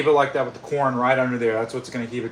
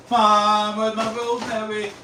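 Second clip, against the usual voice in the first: high called-out question; yelling across the room; 8 semitones higher, slower than usual. Real, not acted. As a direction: call out to another room. Calling to mom offscreen.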